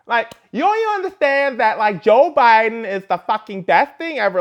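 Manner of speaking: high mock celebrity voice, badly done. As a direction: pitch it up and gush, half-hearted. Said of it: "that was a horrendous impersonation of [name]".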